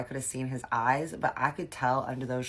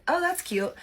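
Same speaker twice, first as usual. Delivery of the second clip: sarcastic. Retold or imitated. Mocking his lowball offer.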